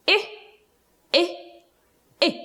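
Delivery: I, I, I as separate short "ih" sounds with pauses; short i drill. Shown as a demonstration. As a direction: model I as a short "ih" three times, spaced out. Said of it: "One more time".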